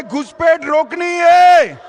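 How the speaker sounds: shouted rally slogan. Real, not acted. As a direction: belt it out again for the crowd. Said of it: "जोर से बोलिए".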